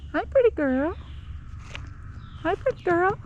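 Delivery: high sing-song pet voice. Real, not acted. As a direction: coo up high to the kitty. Calling cat, very high.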